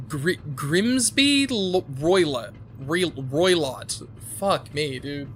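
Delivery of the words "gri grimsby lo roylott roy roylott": Grimsby and Roylott sounded out slowly, broken starts; stumbling over a name. Retold or imitated. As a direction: fumble Roylott syllable by syllable. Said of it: "bad at pronouncing names".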